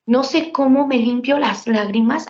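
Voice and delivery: normal talking voice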